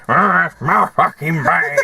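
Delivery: lightly voiced slurred crackhead; a hint of a drunk clutching his bag. Mimicking the crackhead to his mate, lightly slurred. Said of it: "And he's like".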